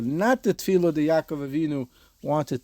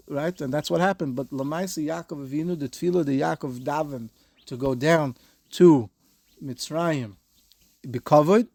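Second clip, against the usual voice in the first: usual speaking voice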